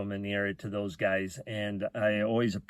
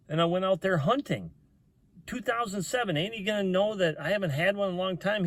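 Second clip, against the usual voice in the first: worked-up complaint; 8 semitones higher, faster than usual. Retold or imitated. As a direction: whine it high, as if dreading the ticket. Acted fretting over getting a ticket.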